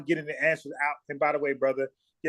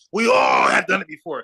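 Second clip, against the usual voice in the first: raised, louder mock excuse; mocking quote; 4 semitones higher, 6 dB louder than usual. Scolding someone who claims to be a leader, mocking quote. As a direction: throw the excuse back louder and higher.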